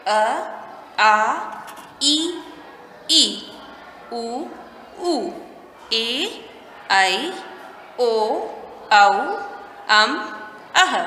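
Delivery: each vowel अ, आ, ई said alone with a pause, like a classroom drill; slow rote chant of अ आ इ ई for pupils to repeat; teacher chanting the Hindi vowels one by one; reciting the vowels. Shown as a demonstration. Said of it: "तो हम पहले ये स्वर पढ़ेंगे".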